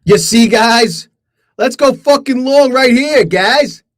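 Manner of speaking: high, loud hype; hyped up. Real, not acted. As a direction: shout it with excitement. Hyped over trade win.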